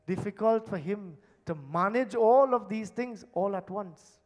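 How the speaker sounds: ordinary talk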